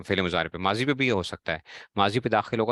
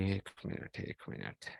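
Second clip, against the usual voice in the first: breathy whisper, barely audible; whispered aside; 16 dB quieter, slower than usual. Real, not acted. Teacher whispering an aside off-mic, hushed whisper. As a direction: whisper it away from the class, one moment.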